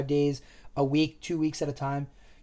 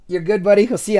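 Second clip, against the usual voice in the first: louder, higher teasing call; roommate calling out; 5 semitones higher, 9 dB louder, faster than usual. Retold or imitated. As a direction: call it out cheerfully to your buddy. Roommate voiced, loud.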